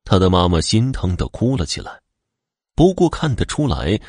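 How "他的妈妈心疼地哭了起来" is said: ordinary delivery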